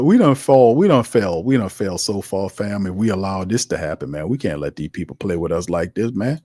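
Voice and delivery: ordinary talk, nothing marked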